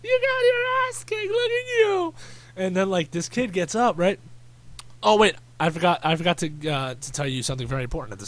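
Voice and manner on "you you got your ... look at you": very high mock-hysterical crowing; hysterical onlooker. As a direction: imitate an overexcited hype man screeching. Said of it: "he's just running around like".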